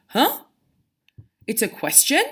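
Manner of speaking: "Huh?" and "question" each lift at the end; questioning rise. Shown as a demonstration. Teacher showing a question mark, rising on "question". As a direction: ask it, voice climbing on "Huh?" and "question". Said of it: "make your voice go slightly up".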